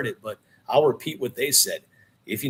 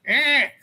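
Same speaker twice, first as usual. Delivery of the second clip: high, loud cat yowl; sharp, high distressed mewl; imitated cat cry; 13 semitones higher, 5 dB louder than usual. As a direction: squeal like the unseen cat crossing the road. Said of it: "Just the sound goes".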